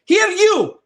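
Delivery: high, loud Scottish yell; sudden Scottish outburst; Scottish shout. Retold or imitated. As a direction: bark it in a hammed-up Scottish accent. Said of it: "what the Scottish guy says".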